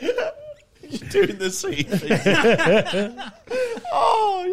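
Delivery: words squeezed out through real high laughter, very slow; can barely speak for laughing; laughing hard. Real, not acted. Cracking up at the joke.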